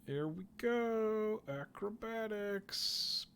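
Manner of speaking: high, stretched-out announcement; drawn-out singsong. Pretend. Player announcing an acrobatics check, drawn-out singsong. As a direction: sing out each word slowly, up high.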